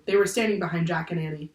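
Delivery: usual speaking voice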